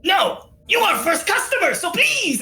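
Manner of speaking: high, eager little merchant creature; Mimips. Acted. Merchant begging his first customers to buy, eager creature voice. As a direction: plead in a high critter voice.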